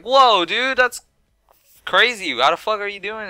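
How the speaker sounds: high-pitched amazed outburst; astonished. Retold or imitated. Figure vanished before his eyes, high amazed exclaim. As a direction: gasp it out like you can't believe it.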